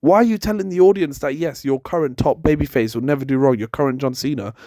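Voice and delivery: usual speaking voice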